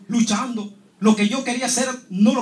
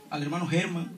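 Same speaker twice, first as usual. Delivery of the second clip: normal talking voice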